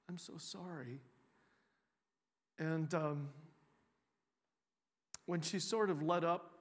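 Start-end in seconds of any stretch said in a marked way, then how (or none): 0.1-1.0 much softer repeat of I'm so sorry, trailing away; near whisper. Retold or imitated. To the crying woman, a barely audible second sorry. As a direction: let the second one fall almost silent. Consoling a woman in full cry.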